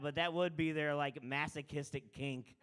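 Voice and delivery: normal talking voice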